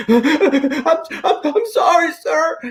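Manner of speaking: imitating a crying woman. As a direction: send up the agent breaking down on the phone. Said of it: "She was dry heaving".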